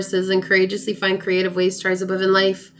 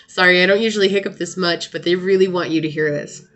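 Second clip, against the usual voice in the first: ordinary talk, nothing marked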